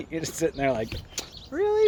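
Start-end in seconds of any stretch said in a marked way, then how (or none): 1.5-1.9 incredulous. Retold or imitated. Incredulous reaction retold.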